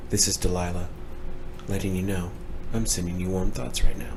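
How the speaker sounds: soft, low late-night radio host voice, lightly done; ASMR radio host voice. Pretend. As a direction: purr it like a soothing radio DJ. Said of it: "You're doing your A S M R voice".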